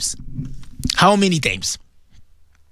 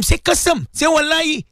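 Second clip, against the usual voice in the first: goading friends; 10 semitones higher than usual. Retold or imitated. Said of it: "And you telling them".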